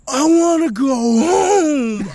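high, slow crying whine; imitating a drunk friend crying to go home; mock crying. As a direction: whimper like a drunk kid.

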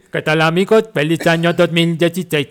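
loud commanding general reading. Acted. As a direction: announce it like an army general. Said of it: "que lo lea el general".

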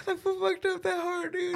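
laughing. Real, not acted. Laughing at his own joke.

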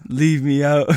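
ordinary talk, nothing marked

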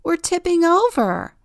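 high loud alarmed shout; panicked yell. Acted. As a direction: cry out as you tip. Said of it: "yells Turtle".

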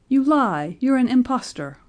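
loud, high outburst of outrage; furious storybook denial; angry accusation. A narrator's reading. Father angrily accuses the old man.

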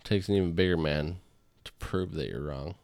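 ordinary talk, nothing marked

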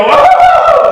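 hammed-up frustrated "Oh," shouted very high; exasperated cry. Parent hitting a wall in life, hammed-up high frustrated cry. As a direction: throw up your hands with a big mock wail.

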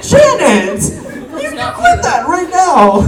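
high, loud, drawn-out mock outrage; hammed-up scolding. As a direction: yell a theatrical telling-off, stretching the words. Acted scolding of grandmother.

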